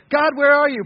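high, loud demand; crying out to God. Acted. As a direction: call out for an absent God. Acting David's complaint to God.

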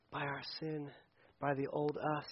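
ordinary delivery

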